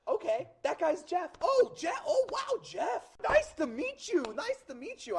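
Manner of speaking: put-on high-pitched, impressed Boston kid; thick Boston accent. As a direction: greet them as a starstruck Boston kid. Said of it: "talks with a thick Boston accent".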